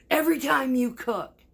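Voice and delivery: loud, high mock rant; imitated angry yelling. Ex griping at the woman who cooked, imitated angry yelling. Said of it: "he screams".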